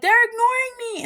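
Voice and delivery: high-pitched whiny kid imitation; mock tattling. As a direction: complain to teacher like a tattletale. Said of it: "he goes to the teacher and he's like".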